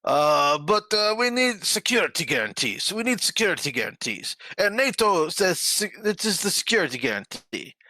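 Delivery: high-pitched imitation of a pleading leader; mock Zelensky. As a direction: put on a high voice to mock a politician's demands. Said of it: "he's like".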